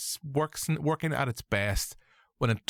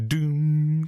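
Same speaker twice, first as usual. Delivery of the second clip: "Doom" louder and higher; mock booming voice, second try; 5 semitones higher, 6 dB louder than usual. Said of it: "No, I can't do him".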